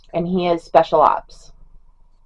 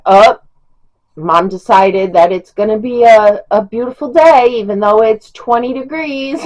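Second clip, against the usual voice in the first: higher, louder sing-song teasing; mock announcement; 4 semitones higher, 4 dB louder than usual. [name] announcing mom's freezing beautiful day, mockingly. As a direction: tease mom with a rising mock-grand announcement.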